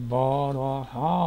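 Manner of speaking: بارہا stretched very slow with a rise; drawn-out headword. Shown as a demonstration. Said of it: "آگے ہے".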